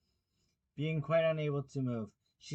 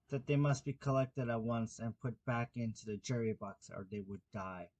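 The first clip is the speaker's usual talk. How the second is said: ordinary delivery, nothing marked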